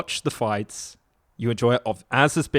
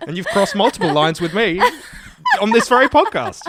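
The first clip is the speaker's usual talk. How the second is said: laughing; 8 semitones higher, 7 dB louder than usual. Real, not acted. Laughing while teasing.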